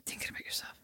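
soft teasing whisper. Real, not acted. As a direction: breathe it as a tease. Teasing list of what she does.

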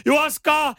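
imitated high-pitched holler; retold shout. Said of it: "hän huutaa".

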